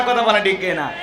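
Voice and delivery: ordinary talk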